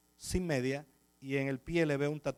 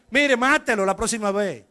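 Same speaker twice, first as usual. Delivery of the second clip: louder, blunt order; gruff captain; 4 semitones higher, 9 dB louder than usual. Retold or imitated. Captain telling the pastor to kill the thief, louder gruff. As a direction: bark it like a fed-up police captain.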